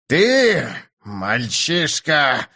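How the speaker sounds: slow, furious growl. A narrator's reading. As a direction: snarl the insult. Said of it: "зарычал он".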